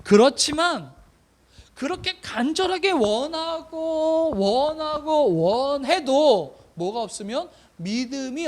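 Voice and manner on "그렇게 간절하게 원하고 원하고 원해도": slow, elongated words piling up the yearning; drawn-out longing. Shown as a demonstration. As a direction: stretch each repeated wish out slowly. Dramatizing desperate wishing, drawn out. (간절하게 길게 늘여서)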